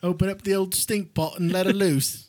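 high, hammed-up English voice; mock English accent. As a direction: play along in silly English tones. Carries on the English doctor bit.

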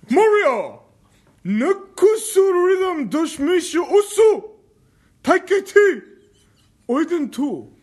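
loud, high, over-the-top mock-Japanese announcer shout; Tano voice. [name] reads game titles in Tano voice, hammed-up shouting. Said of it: "And do one in Tano voice".

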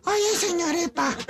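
high, put-on voice of a kid; high mock kid voice; imitating his voice. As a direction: mimic a little boy's voice. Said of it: "con su voz".

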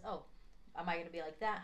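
usual speaking voice

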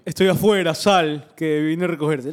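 mocking a crude guy calling her out; mock pushy call. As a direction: ham up a pushy guy outside her door.

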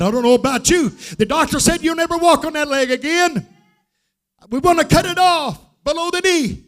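high, urgent testimony; fervent high-pitched preacher's cry; full-force preaching. Real, not acted.

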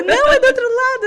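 high and loud urgent reply; rushed loud correction; hurried shout. Retold or imitated. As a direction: call it out on the run. Sister answering in the rush.

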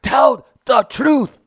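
high shouted slow Will Smith Concussion accent; Will Smith Concussion accent shouting tell the truth. Shown as a demonstration. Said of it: "my Will Smith and concussion accent".

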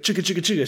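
quick chattering rattle noise; imitated car shaking. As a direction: mimic the judder of a faulty car. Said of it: "it just is like shake".

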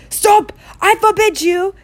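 loud, high panicked yell. A narrator's reading. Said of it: "yelled Uncle Vernon in panic".

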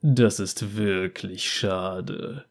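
low, slow, quiet mock regret; bounty hunter's mock pity. A narrator's reading. Bounty hunter mocks the girl's story, low and slow.

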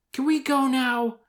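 high, loud impatient plea; Ron Weasley. Acted. Boy bored at the council, impatient. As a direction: whine to leave, high and pushy.

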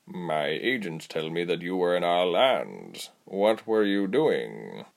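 slow, drawling purr; big tabby creature, unhurried and purring; purring cat. Acted. Tabby explaining beasts to the captives, purring.

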